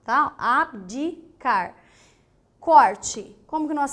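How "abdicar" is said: Abdicar drawn out slowly, each consonant sounded; slow syllables. Shown as a demonstration. Teacher slowly sounds out Abdicar. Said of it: "o B tem som e o D tem som".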